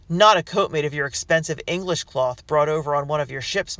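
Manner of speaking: loud, sneering taunt; mock him through the door. A narrator's reading. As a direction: rebel jeering at the merchant, taunting. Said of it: "taunted another".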